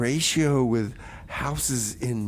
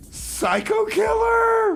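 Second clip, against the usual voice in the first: announcer yell; much slower than usual. Acted. Announcing a song title.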